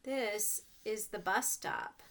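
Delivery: usual speaking voice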